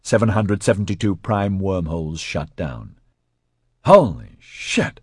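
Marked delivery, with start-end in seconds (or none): 3.9-5.0 loud, high, drawn-out exclamation; exclaiming. A narrator's reading. Oscar sees the attack data, exclaiming in astonishment. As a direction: burst out, stretching the words slowly. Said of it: "Oscar exclaimed".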